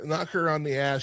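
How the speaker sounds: ordinary talk, nothing marked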